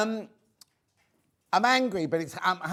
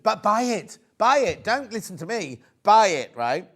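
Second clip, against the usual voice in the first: louder and louder, chanting buy it; excited loud urging; 5 semitones higher, 8 dB louder than usual. Real, not acted. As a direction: push the viewers to buy, rising to a shout.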